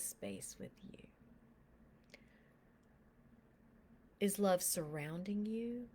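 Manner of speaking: soft, unhurried prayer guide, often whispered; guided meditation. Acted. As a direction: lead them gently into prayer with eyes closed.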